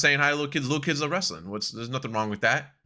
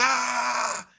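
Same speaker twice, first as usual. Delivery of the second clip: high mock cheer of a crowd; high imitated crowd cheer; crowd roar imitation; 11 semitones higher than usual. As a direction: mimic the crowd going wild, pitched up. Crowd goes nuts.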